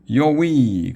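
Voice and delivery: high, loud whoop of amazement; excited whoop. A narrator's reading. Dudwin seeing the ghosts through the necklace, high whoop. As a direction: let out a thrilled yell. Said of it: "cried Dudwin".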